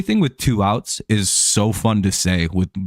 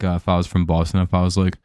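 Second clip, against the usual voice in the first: ordinary talk, nothing marked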